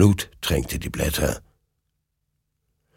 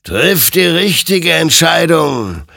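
slow, loud, high warning; grand, ringing admonition; admonishing; 11 semitones higher, 7 dB louder than usual. A narrator's reading. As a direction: exhort him with drawn-out force. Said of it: "ermahnte ihn sein Gegenüber".

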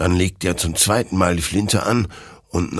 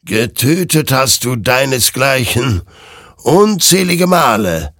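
accusing creature; 7 semitones higher, 6 dB louder than usual. Acted. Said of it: "warf die Kreatur ihm vor".

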